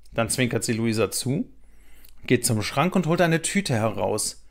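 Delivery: plain reading voice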